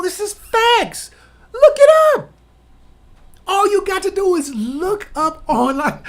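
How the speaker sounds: high, emphatic shout; exasperated insistence. Real, not acted. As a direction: hammer it home at the top of your voice, stretching the key words. Exasperated insistence that these are facts.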